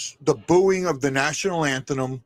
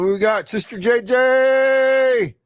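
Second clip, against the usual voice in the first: drawn-out greeting; 9 semitones higher, 6 dB louder, much slower than usual. Real, not acted. Greeting a viewer joining.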